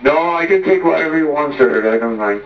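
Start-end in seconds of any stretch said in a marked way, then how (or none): none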